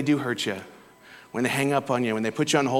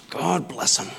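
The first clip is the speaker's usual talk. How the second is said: imitating Roberta; 4 semitones higher, slower than usual. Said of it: "she goes".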